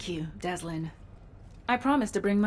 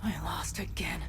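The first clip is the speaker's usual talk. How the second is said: whisper; 3 semitones lower, 4 dB quieter than usual. Real, not acted. Alone after losing the battle.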